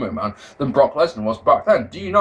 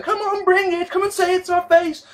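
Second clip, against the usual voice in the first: put-on much higher, sassy girl voice; mocking Bella taunt. Mocking the Bellas taunting AJ, high and sassy. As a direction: taunt like a catty reality star. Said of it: "Brie and Nikki Bella sat there".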